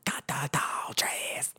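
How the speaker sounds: breathy unvoiced mock scream, slow; whisper-scream it slowly. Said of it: "I'm gonna throw in so many whisper screams".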